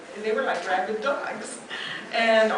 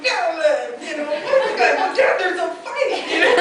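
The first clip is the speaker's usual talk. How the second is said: higher and louder, breaking into laughter; laughing through the retelling; 8 semitones higher, 11 dB louder than usual. Retold or imitated. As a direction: retell dad's panic while cracking up. Retelling chaotic casino fight, laughing.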